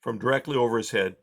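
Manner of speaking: ordinary delivery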